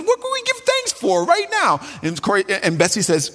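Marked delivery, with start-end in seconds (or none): 0.0-1.8 eager. Retold or imitated. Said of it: "Corrie looked around the room and said".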